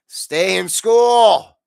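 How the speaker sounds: slow, loud bellowed warning; shouted advice. Acted. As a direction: yell it slowly like a stern warning.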